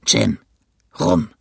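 very low, rough captain's voice; stricken captain. Acted. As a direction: demand it in a deep, rough voice. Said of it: "sagte zu mir". (tiefe, raue Stimme des angeschlagenen Kapitäns)

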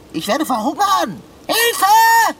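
high panicked shout for help; loud, desperate cry; bellowing. Acted. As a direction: escalate from alarm to a full yell. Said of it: "lass das Brüllen".

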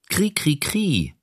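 high, slow chirping kri; cricket chirp. Acted. Narrator imitates the cricket.

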